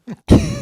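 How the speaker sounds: sudden high cackle of delight; burst of laughter. Real, not acted. Vlogger bursts out laughing at the interview clip.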